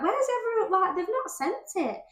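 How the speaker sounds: high, baffled "where's everyone" cry; mock bewilderment. Stylist retelling confusion over missing payments, high and baffled. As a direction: look around for missing money.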